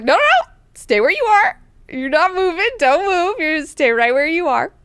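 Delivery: loud, high hammed-up order to stay put; commanding shout. Leto ordering the retreating Mineo to stay, loud and high. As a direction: bark him frozen in place.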